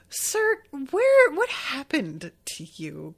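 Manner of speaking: exasperated disbelief at a grown man; real disbelief, high and slow; incredulous. Real, not acted. Exasperated reaction to the tweet.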